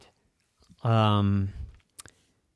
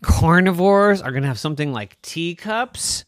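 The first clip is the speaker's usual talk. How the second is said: high, loud scoffing father; scoffing dinosaur dad; 8 semitones higher, 7 dB louder than usual. Acted. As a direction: scoff at the neighbours. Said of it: "Daddy said".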